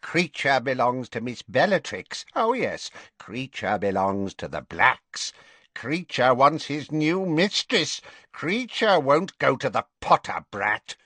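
high croaking elf voice, sullen and defiant; a grumbling elf refusing his new master; stubborn house elf. A narrator's reading. House elf's voice held in narration.

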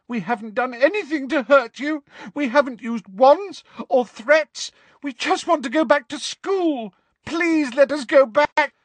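high, desperate pleading; Hermione, tearful shouting. Acted. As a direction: beg at full pitch, building to a loud cry. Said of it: "shouted Hermione, real tears".

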